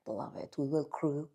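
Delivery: usual speaking voice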